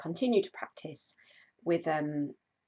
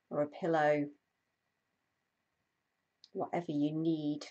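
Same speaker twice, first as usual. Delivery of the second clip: guided meditation; 3 dB louder than usual. Acted. Host guiding a live stream body scan, calm meditation voice. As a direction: ease listeners into stillness, unhurried.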